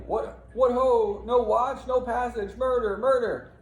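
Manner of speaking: high, loud cry for the watch; yelling from afar. Acted. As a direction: shout murder into the night. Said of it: "they hear him off in the distance yelling".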